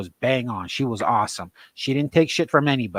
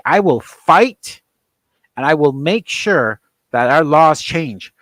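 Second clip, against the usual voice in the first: louder, fierce declaration; defiant vow; 2 semitones higher, 5 dB louder than usual. Real, not acted. Father vowing to fight for his kids, loud defiant declaration. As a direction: swear it like a battle cry.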